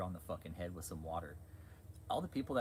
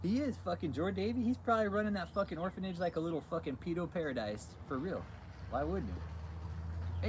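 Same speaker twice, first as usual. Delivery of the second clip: ordinary talk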